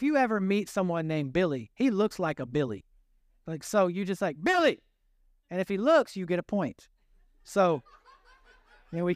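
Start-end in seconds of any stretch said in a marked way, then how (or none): 4.4-4.8 high, loud call across a crowd; calling out a name. Shown as a demonstration. Preacher shows the name game with a high, loud call. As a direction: shout Billy to make a stranger turn.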